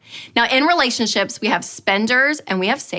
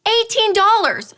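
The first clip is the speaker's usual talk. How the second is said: shocked repeat; 12 semitones higher, 3 dB louder than usual. Retold or imitated.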